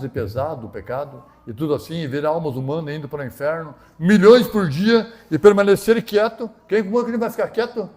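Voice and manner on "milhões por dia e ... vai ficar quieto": impassioned preaching. Real, not acted. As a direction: stretch the words out in urgent appeal. Preaches at full force.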